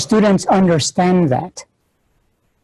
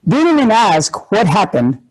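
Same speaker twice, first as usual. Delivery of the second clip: ordinary talk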